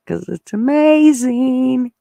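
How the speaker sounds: drawn-out, high "amazing"; excited reveal. Real, not acted. Showing her painted lawn to viewers, excited and slow.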